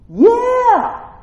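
high, loud, drawn-out affirmation; emphatic excited yes. Real, not acted. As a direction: answer with a joyful shout. Answering own mock question loudly.

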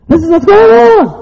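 very high, loud pulpit cry; rousing shout. Real, not acted. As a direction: shout to wake the congregation up. Rousing a sleepy congregation.

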